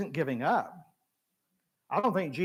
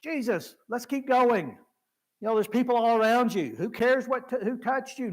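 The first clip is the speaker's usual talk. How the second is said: higher, louder urging to move on; impatient apostles; 6 semitones higher, 4 dB louder than usual. Retold or imitated. Apostles urging Jesus onward, impatient and raised. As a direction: brush the question aside, pushing to keep going. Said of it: "they're saying".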